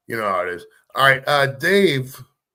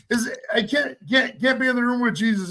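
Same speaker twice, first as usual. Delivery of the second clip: choked up; 8 semitones higher than usual. Real, not acted. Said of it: "[name]'s getting tearing up".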